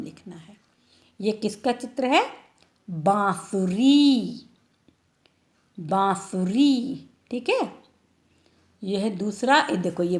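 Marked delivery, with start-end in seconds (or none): 2.9-7.1 slow, clear naming; drawn-out word. Shown as a demonstration. Teacher naming the flute picture slowly for children. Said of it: "naming picture slowly".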